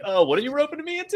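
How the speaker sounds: high, fast mock alarm. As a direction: squeak it out in fake panic. Acted alarmed quote in retelling.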